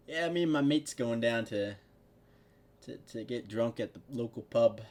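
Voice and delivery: half-hearted British pub-goer; bad British accent. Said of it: "I can't do a British accent".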